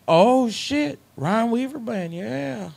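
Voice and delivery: put-on high excited kid voice; excited local kid. Gas station kid to the singer, high and excited.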